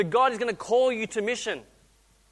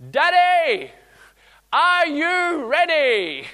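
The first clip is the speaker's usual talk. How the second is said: put-on high, loud child's call, slowed; a child calling from the pool edge; little girl calling; 9 semitones higher, 9 dB louder, much slower than usual. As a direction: call out like an eager four-year-old. Daughter yelling from pool edge.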